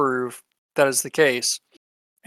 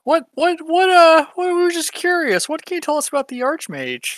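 high flustered voice; 12 semitones higher, 5 dB louder than usual. Acted. Player voices his character.

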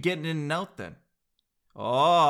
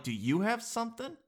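mock suspicious question; 8 semitones higher, 7 dB quieter than usual. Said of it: "I'm like".

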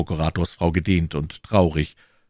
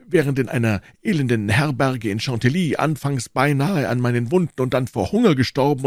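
raised, emphatic voice of a wounded lover; higher, reproachful character voice; aggrieved Porthos; 7 semitones higher than usual. A narrator's reading. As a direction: declaim the grievance with theatrical indignation.

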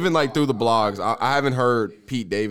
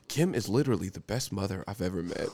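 Skeet; 5 semitones lower, 9 dB quieter than usual. Acted. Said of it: "I gotta hear your Skeet voice".

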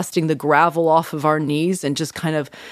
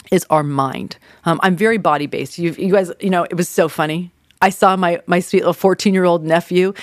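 ordinary talk

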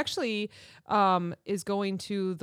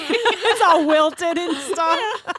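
laughing; 8 semitones higher, 8 dB louder, much slower than usual. Retold or imitated. Retelling soggy lunch, laughing.

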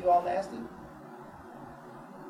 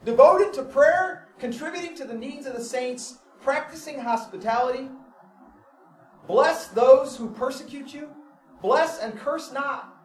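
high, loud fervent preaching; preaching at full force; 11 semitones higher, 8 dB louder than usual. Acted. Pastor preaching Romans to the congregation, fervent and loud. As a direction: preach it out, lifting every phrase.

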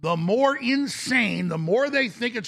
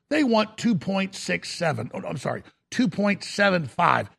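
ordinary talk

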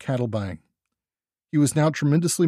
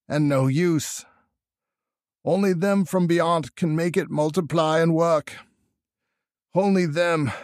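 put-on Old Whately; 3 semitones higher than usual. A narrator's reading. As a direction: labour out the last instructions as an old countryman. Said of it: "he added another sentence or two".

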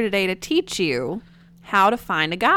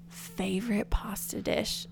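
whisper; 10 dB quieter, slower than usual. Real, not acted. Repeating the question to herself.

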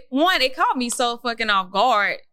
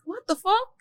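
pitched up high in disbelief; stunned disbelief; 11 semitones higher than usual. Retold or imitated. Telling friend about ex's call, high shocked disbelief. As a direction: react like the call came from nowhere.

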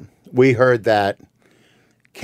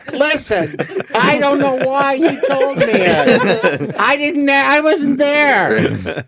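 high-pitched mock panicked denial; mock pleading; much slower than usual. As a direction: squeal it out in comic desperation, stretching the last words. Acts out blurting out a denial.

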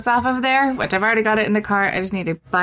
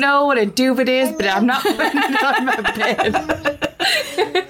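laughing; 4 semitones higher than usual. Real, not acted. Laughing at the duvet.

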